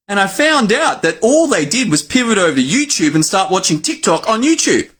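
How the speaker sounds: ordinary talk, nothing marked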